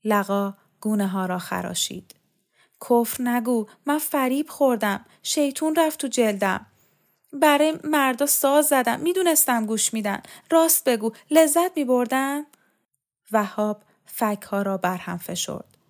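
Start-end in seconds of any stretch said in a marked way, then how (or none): 2.9-12.5 high-pitched, agitated confession; distraught aunt. A narrator's reading. Aunt confesses to her nephew that she was tempted, distraught. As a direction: blame yourself in a raised, fretful voice.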